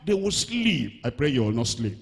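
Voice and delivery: normal talking voice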